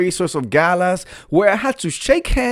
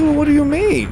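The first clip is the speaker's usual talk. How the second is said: high-pitched indignant protest; high, hammed-up incredulity; affronted disbelief; 11 semitones higher than usual. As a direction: squeal it, offended and insistent. Offended comeback to husband's dig.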